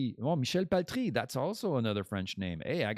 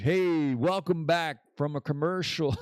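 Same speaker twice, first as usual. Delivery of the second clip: louder, higher, a broadcast host's welcome-back; radio host intro; 6 semitones higher, 5 dB louder than usual. Shown as a demonstration. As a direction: practice the welcome back like a show announcer. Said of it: "Okay, well, I'm practicing".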